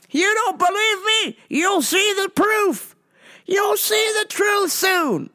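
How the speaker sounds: mock zealot lecturing a cashier; preachy Rome guy. Man threatens proof.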